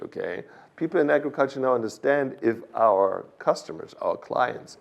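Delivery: ordinary delivery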